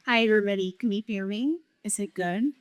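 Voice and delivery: ordinary delivery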